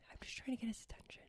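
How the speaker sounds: quick whispered excuse; hushed aside. Real, not acted. Host explaining herself about the co-host, whispered. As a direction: whisper it fast, confidingly.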